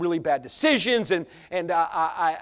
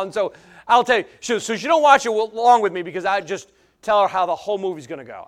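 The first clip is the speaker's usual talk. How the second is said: usual speaking voice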